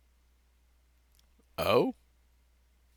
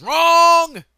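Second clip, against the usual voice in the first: high, drawn-out shout on wrong; sudden yelling; 19 dB louder than usual. Acted. Speaker admitting the methane mistake, sudden stretched yell. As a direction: yell it, stretching the last word way up. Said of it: "Sorry for yelling".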